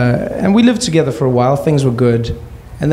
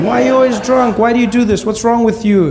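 mock mother's shrill telling off; imitating mom shouting; 11 semitones higher than usual. Mom yelling at drunk husband, high mock shriek. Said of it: "she started to shout".

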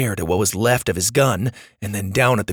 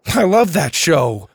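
loud, high, drawn-out enthusiasm; gushing; 5 semitones higher, 5 dB louder than usual. A narrator's reading. Max to the driver about his favourite show, gushing. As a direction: gush like a thrilled fan.

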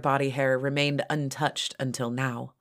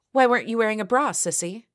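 quick, high, louder demand; rapid, raised-pitch taunting question; mocking demand; 7 semitones higher, 3 dB louder than usual. A narrator's reading. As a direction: fire the question off fast and high. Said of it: "demanded Elizabeth".

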